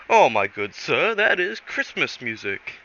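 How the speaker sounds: a horrible, hammed-up posh hunter; knowingly awful posh accent; bad Hammerlock impression. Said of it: "horrible, horrible impression of him".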